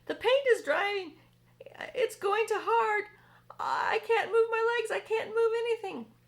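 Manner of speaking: high, loud near-crying bug voice; panicked centipede. Acted. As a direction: whimper in panic as the paint hardens. Centipede panics stuck in paint.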